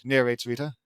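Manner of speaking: ordinary delivery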